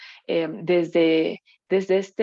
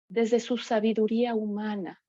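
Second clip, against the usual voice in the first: ordinary talk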